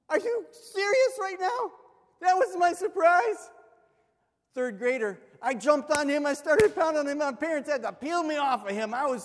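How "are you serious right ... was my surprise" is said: high, loud outraged disbelief; outraged kid. Retold or imitated. Pastor reliving his childhood outrage at his brother, high loud indignation. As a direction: shout the betrayal high.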